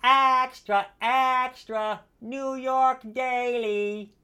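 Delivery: put-on high, far-off kid shouting the papers; newsboy call. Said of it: "this young animatronic would say".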